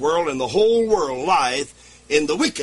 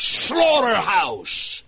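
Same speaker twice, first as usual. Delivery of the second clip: very slow, loud, high "slaughterhouse"; drawn-out scorn; 5 semitones higher, 4 dB louder, much slower than usual. Acted. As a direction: stretch "slaughterhouse" out in disgust. Preacher mocks liberal view.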